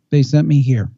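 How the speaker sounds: usual speaking voice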